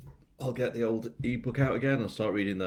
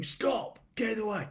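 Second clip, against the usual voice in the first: put-on kids calling out; 14 semitones higher than usual. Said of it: "Have loads of kids going".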